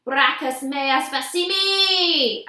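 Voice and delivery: slow, loud Latin battle cry; yelled. A narrator's reading. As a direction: shout the Latin curse. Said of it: "I yelled".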